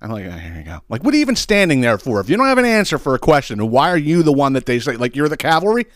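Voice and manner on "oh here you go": much softer, low sarcastic aside; low scornful mumble; sarcastic mutter. Retold or imitated. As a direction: drop it under your breath with scorn. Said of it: "I'm like".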